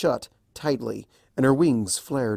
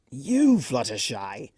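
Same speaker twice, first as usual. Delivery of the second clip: slow, drawn-out delighted call; exclaiming; much slower than usual. A narrator's reading. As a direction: greet her with theatrical delight. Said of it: "Discord exclaimed".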